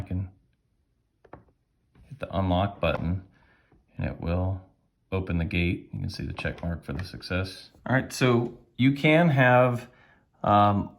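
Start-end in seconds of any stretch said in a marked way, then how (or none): none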